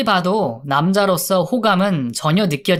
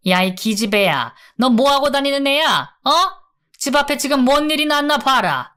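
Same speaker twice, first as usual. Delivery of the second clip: high-pitched acted nagging, building higher; scolding mother; 6 semitones higher than usual. A narrator's reading. Mother drives hungover daughter out of the house, scolding. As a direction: nag like an exasperated mother, peaking on the order.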